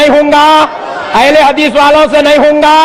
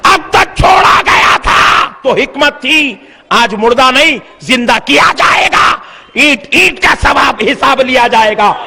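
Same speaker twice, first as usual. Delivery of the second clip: preaching at full force. Acted. Preacher warning of God's judgment over the Babri mosque, shouted preaching. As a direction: thunder God's reckoning at them.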